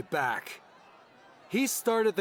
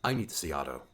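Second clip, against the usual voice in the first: deep, set voice, much lower; low grim Sim; 13 semitones lower, 4 dB quieter, much faster than usual. A narrator's reading. Sim tells Leo he must see Otto, low and grim. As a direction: drop low and serious.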